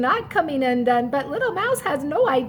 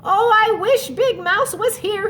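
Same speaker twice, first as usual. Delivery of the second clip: higher, plaintive cry; 6 semitones higher, 4 dB louder than usual. Acted. Little Mouse fallen on his bottom, crying out. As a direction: call out for help, scared. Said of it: "he cried".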